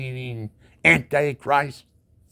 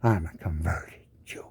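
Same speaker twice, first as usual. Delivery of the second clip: softly whispered aside; 8 semitones lower, 6 dB quieter than usual. Real, not acted. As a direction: drop to a whisper, confiding.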